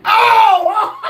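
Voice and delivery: loud, very high "oh" into laughter; real high-pitched burst of helpless laughing; shocked laughing outburst. Real, not acted. Host reacts to crude news off-mic, shocked laughing.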